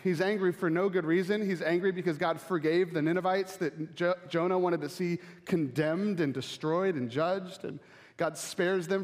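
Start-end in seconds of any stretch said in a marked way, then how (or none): none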